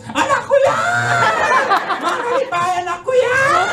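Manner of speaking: high shrill proud mother calling out; mother shouting proudly from the window. Retold or imitated. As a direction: shout it high like a thrilled mom.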